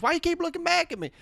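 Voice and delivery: high, soft southern cop imitation; southern accent. Cop asking nervous passenger why he looks back, southern accent. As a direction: ask it with a southern twang. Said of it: "he had a southern accent".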